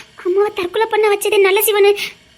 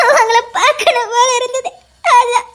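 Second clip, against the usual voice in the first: tearful half-laugh; crying and laughing. Acted. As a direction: smile through the tears. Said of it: "ஏமா அழுற?". (அழுகையும் சிரிப்புமாக)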